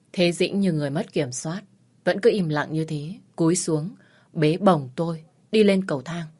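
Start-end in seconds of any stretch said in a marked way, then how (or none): none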